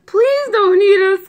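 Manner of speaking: high, loud begging; pleading cry. A narrator's reading. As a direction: beg the monster in a high storybook wail. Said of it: "cried the dogs".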